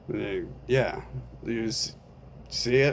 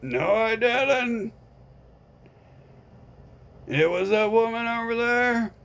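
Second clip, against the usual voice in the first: a higher, offhand friend voice; lightly voiced friend; 13 semitones higher, 6 dB louder than usual. Friend refusing to help, mildly raised voice. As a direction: shrug it off, unbothered.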